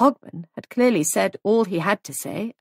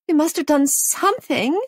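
high, loud wail; 9 semitones higher, 4 dB louder than usual. Acted. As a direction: wail in distress. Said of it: "wailed Molly".

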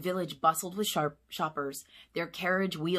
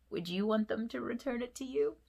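ordinary delivery, nothing marked

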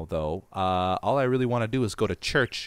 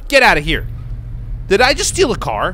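higher and louder shocked outburst; can't believe what just happened; 9 semitones higher, 4 dB louder than usual. Real, not acted. Gamer stealing a truck by accident, shocked and laughing.

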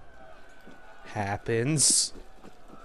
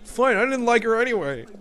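mock whiny dumped lover; 9 semitones higher than usual. Mock whiny rant after breakup.

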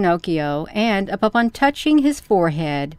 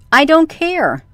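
defiant shout; 7 semitones higher, 3 dB louder than usual. A narrator's reading. Defiant child.

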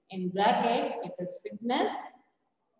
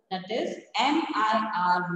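ordinary talk

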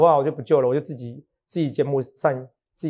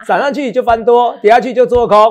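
mocking; 6 semitones higher, 6 dB louder than usual. Mocking technical analysts' easy calls.

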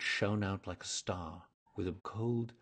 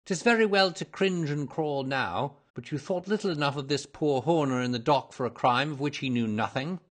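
mildly scornful reproach; hint of a stern accuser; lightly voiced detective, stern; 6 semitones higher, 7 dB louder than usual. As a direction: reprove firmly, without shouting.

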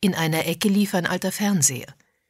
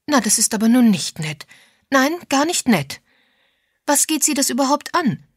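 higher, louder offended retort; indignant; 8 semitones higher, 5 dB louder than usual. A narrator's reading. Miss Sims to Agatha, asked about the money, indignant. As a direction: push back indignantly at a nosy question.

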